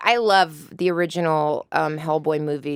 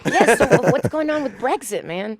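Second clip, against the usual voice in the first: put-on playful British accent; 9 semitones higher, 6 dB louder than usual. Playing two British people.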